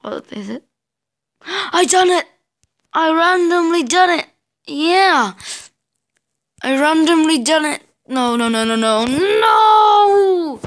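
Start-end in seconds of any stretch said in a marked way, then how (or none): 1.5-7.8 gasping, high and loud with joy; excited cheer. Real, not acted. Finally clears the hard bit. 8.1-10.6 anguished shout. Real, not acted. Is failing the level.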